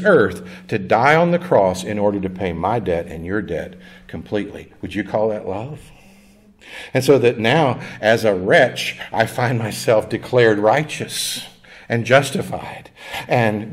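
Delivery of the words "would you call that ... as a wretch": normal talking voice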